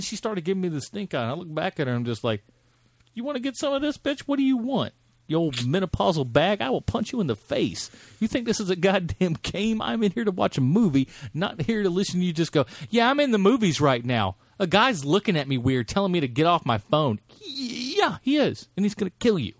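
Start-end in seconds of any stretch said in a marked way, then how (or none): 3.1-17.2 high, hammed-up tirade at a stranger; mock angry rant. Retelling a movie theater fight, mock angry rant. 17.4-19.5 mock menace.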